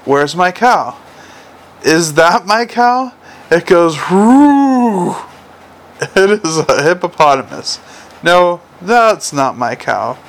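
slow, dramatic picture-book voice with a big rising hoot; hammed-up animal-book delivery; reading to children. A narrator's reading. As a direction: read it with relish to a toddler.

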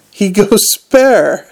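SPARE drawn out high and loud; emphatic alarm. A narrator's reading. Nobby insists on boss's fury.

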